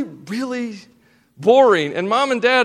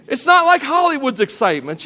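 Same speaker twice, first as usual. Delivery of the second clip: ordinary talk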